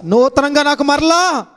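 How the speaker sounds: high, loud climactic preaching; preaching at full force. Acted. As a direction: build the sermon to a ringing peak. (ఉద్రేకంతో బోధించే స్వరం)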